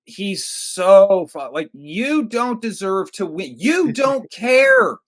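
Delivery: slow, high, loud outrage; exasperated rant. Real, not acted. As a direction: drag out the disbelief and shout. Ranting about Rocky's attitude.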